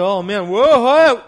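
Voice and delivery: loud, rising alarmed cry; sinking and suddenly scared; panicked whoa. Acted. Peter on the water losing nerve, loud alarm.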